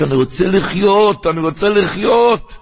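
high mock cry, not shouted; imitated desperate cry. Preacher acting Lot begging to live, high imitated cry. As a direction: cry it out high, pleading for life. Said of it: "הוא צעק".

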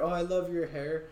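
a hint of the doting ex; mock girlfriend. Ex girlfriend praising his hair, lightly mocked gushing.

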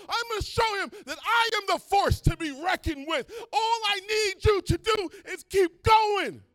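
booming shouted command, high and loud; God shouting. Acted. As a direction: roar it out as God pushing Moses on. God voiced in the Exodus retelling.

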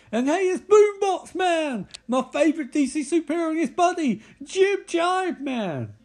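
high, over-the-top superhero introduction; mock-excited announcement. Announcing his favourite superhero and his buddy, mock-excited.

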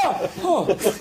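high mock-startled gasps. Professor startled by the small rubber skeleton, high mock fright. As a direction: react with a comic jolt, pitch jumping up. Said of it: "he gets startled each time".

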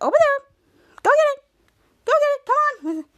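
high, loud, excited calls; acted eager shouts of encouragement; urging on. Team urging the monster on.

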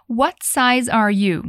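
What size are you said very slowly, every word clear; slow model question. Shown as a demonstration. Teacher slowly models the clerk's question for students, clearly. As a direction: spell it out slowly for the learner. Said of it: "E a pergunta toda é".

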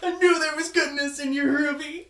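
high, hammed-up movie line; lightly voiced sappy movie hero; mock heroic line. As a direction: gush it like a corny hero. Imitating movie character line.

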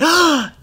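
high sharp mock gasp; hammed-up shocked gasp.

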